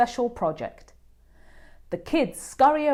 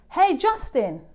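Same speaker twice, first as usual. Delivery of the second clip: high, louder call across the playground; 8 semitones higher, 4 dB louder than usual. A narrator's reading. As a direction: call his name from the tetherball court. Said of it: "Emilio calls out from the tetherball court".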